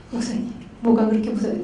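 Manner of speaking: hushed, caring inquiry; gentle counseling question. Retold or imitated. Counselor asking pastor what scares him, soft and gentle. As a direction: ask softly, without pressure.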